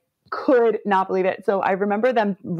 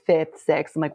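normal talking voice

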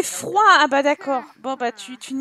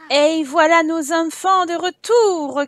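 showman announcement; 3 semitones higher, 4 dB louder, slower than usual. Acted. Announcing the kids' return.

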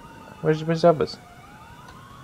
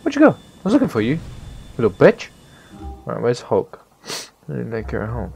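ordinary talk, nothing marked

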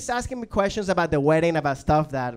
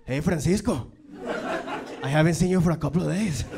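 lightly voiced cartel menace; a hint of a drug-lord threat; Narcos threat; 2 semitones higher than usual. Language app threatening a truant learner, mock cartel menace. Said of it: "it becomes Narcos all of a sudden".

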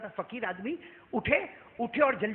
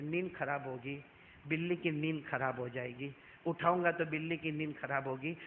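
quiet inner thought; 5 semitones lower, 6 dB quieter than usual. Acted. Abu Huraira's inner thought.

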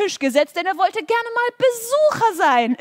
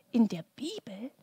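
lower, hushed whisper; 5 semitones lower, 9 dB quieter than usual. Real, not acted. Telling Norbert about the Bible, hushed whisper. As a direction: lean in and whisper it like a secret. Secret aside to puppet.

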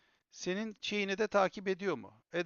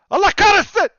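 furious outburst; 19 dB louder than usual. Real, not acted. Fed-up streamer cursing.

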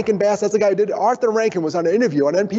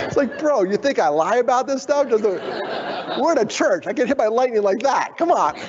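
1 laughing; 8 semitones higher than usual. Real, not acted. Laughing retelling.

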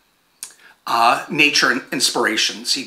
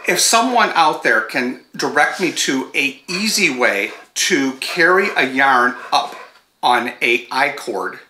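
ordinary delivery

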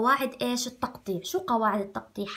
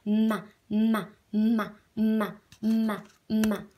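slow, stretched syllables for the lips; slow lip drill; slower than usual. Shown as a demonstration. Teacher showing the lip movement slowly to pupils. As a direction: stretch each syllable so they see your lips move. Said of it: "الكل يعمل هيك شفايفه".

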